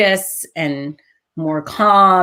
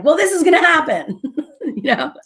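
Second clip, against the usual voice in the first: laughing; 10 semitones higher than usual. Retold or imitated. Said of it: "It was kinda like".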